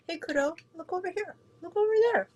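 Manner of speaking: high sing-song pet voice; talking to pet. Real, not acted. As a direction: coax the dog, high and sweet. Calling the dog over.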